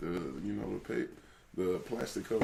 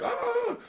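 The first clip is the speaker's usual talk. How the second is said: very high mock squeal; imitated squeal; 5 dB louder than usual. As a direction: hit a falsetto yelp. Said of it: "It's like".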